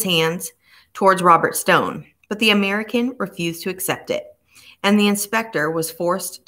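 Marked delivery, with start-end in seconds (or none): none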